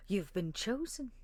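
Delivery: hushed, almost a secret; awed hush. Acted. NPC to the zealot, softly awed.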